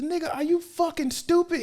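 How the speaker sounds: high-pitched disbelief; mock incredulity. Scolding a friend who took the hit, high mock incredulity. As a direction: squeak it in comic exasperation. Acted reaction to friend's gut shot.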